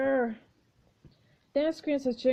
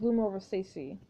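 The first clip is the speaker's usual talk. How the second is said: normal talking voice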